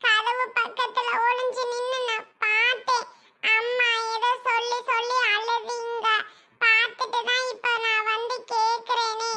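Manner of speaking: put-on concerned little child. Child telling mother they saw her crying, concerned. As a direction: press gently, like a worried child.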